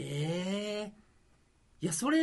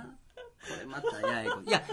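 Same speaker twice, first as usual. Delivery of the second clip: laughing; slower than usual. Real, not acted. Laughing at own mixed identity.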